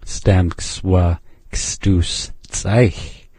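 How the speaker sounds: Stamks wa kstuch said very slowly, syllable by syllable; teacher slowing right down; slow demo. Shown as a demonstration. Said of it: "Hear that ending change?".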